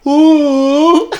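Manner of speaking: high, loud sassy "ooh"; mock a taunting coo. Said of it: "I went".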